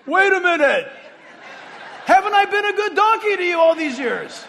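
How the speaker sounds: high, loud, hammed-up protesting donkey voice; a wounded donkey pleading with a laugh in it; talking donkey. The donkey protesting to the man beating him, loud and high.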